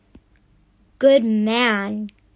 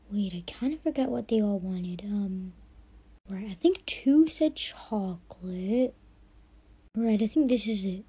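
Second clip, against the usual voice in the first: whispering to herself; 5 semitones lower, 9 dB quieter than usual. Acted. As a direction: whisper it like you forgot the order. Muttering to herself at shop.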